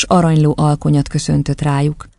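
usual speaking voice

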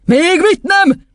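high, drawn-out yell; angry outburst. Acted. Headmistress refusing the boy's request, angry shout. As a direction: explode in indignant refusal. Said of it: "csattant fel Doron kisasszony".

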